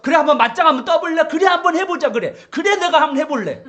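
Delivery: loud, fast mocking dare to fight; sarcastic taunt. Pastor voicing a sarcastic boast to the congregation, loud and fast. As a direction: sneer out a challenge, hammed up and quick. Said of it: "바울이 냉소적이고 비꼬적으로 말하고".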